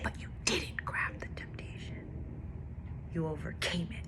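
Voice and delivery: hushed, gentle whisper; quiet whispered reassurance; soft whisper. Retold or imitated. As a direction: whisper it like a loving reminder. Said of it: "the Lord said".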